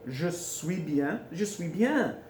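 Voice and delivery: "Je suis bien" slow and drawn out; stretched, careful "Je suis bien"; slow teaching demo. Shown as a demonstration. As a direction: model "Je suis bien" slowly for learners. Teacher models phrase slowly.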